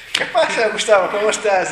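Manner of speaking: lama catching Gustavo, loud cheerful hail; loud hearty greeting. Retold or imitated. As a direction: call out a big cheery greeting. The lama catching him, greeting loudly.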